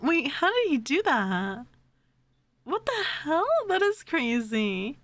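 usual speaking voice

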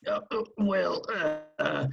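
flustered stammer. Acted. Henchman caught out by his boss, nervous stammer. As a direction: stumble over the excuse like you've been caught.

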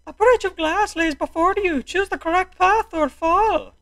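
high, theatrical fake Irish host; terrible Irish accent. Game host introducing glass bridge, terrible Irish accent. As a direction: announce the challenge in a squeaky mock Irish accent. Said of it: "my terrible Irish accent".

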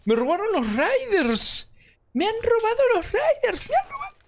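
high, strained wail of disbelief; outraged disbelief. Real, not acted. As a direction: cry out that they've been robbed. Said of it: "Tengo unas ganas de gritar".

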